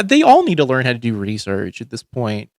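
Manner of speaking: normal talking voice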